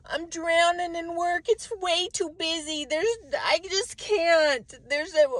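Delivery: lightly voiced stressed coworker; hint of a swamped complainer; mock overwhelmed coworker. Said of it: "I watch someone else say things".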